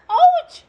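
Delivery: high, loud mock ouch for the baby. Mother imitates a hurt cry to tease the baby, high and loud. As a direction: squeal ouch playfully up high.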